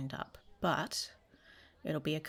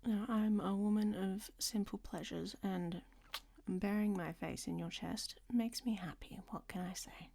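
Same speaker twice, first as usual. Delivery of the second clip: soft, close, breathy bedside whisper; intimate whisper; 2 semitones higher, 5 dB quieter than usual. Acted. Woman whispering to her lover in bed, intimate hush. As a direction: murmur it close to his ear, teasing.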